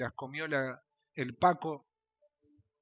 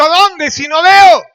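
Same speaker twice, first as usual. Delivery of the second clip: slow, much higher and louder protest; incredulous shout; 12 semitones higher, 22 dB louder, slower than usual. Acted. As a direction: shout it in disbelief. Blind man's imagined protest.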